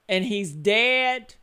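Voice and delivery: ordinary talk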